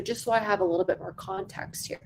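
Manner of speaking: ordinary talk, nothing marked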